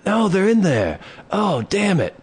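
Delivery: insist, pointing vaguely; insistent drunk guy. Retold or imitated.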